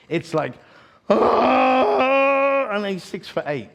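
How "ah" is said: imitated huge wailing sob; loud mock sob. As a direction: bawl one long, high, loud wail, imitating him. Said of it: "so it's loud".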